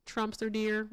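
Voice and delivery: ordinary talk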